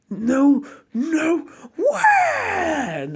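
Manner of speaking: very high crying disbelief; high frightened cry; panicked cry. Acted. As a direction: wail in terror. Gaara panics, wounded.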